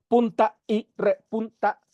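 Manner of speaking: PUNTA, Y, REPUNTA split apart with gaps; word by word. Shown as a demonstration. Teacher shows students how not to scan the verse, word by word. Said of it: "no se debe decir".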